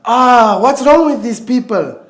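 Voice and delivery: angry, fed-up cry; exasperated outburst. Acted. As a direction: burst out in frustration at the relatives. Outburst at relatives fighting over inheritance.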